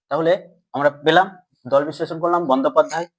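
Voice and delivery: ordinary talk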